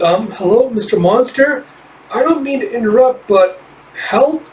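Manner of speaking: high, small timid voice; timid little creature. Acted. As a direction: peep it out nervously, interrupting.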